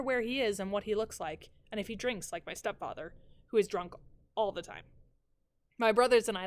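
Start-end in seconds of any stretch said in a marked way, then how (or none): none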